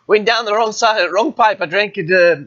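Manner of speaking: ordinary talk, nothing marked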